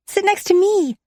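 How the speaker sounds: put-on high, eager little girl's blurt; blurted child voice. A narrator's reading. Sasha inviting Talia to sit beside her, high eager blurt. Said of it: "Sasha blurted".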